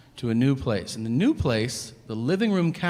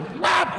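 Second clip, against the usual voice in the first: high, sudden "whap"; imitated whap noise. Comic showing how he flushes, cartoonish whap. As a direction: punch out a high slapping noise.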